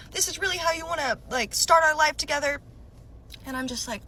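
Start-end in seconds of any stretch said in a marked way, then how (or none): 0.1-2.6 high, accusing question, louder at the end; indignant boyfriend. Retold or imitated. Boyfriend rebukes girlfriend who woke him, indignant. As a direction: imitate an angry partner's rebuke. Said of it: "and he's like".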